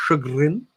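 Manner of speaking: चैग्रिन शब्द को ऊँचे स्वर में आज़माते हुए; trying a new word. Shown as a demonstration. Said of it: "not a word commonly used upon my lexicon".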